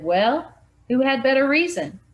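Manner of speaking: loud, high demanding question; insistent challenge. Acted. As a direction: push the point hard. Jeff insisting on his theory.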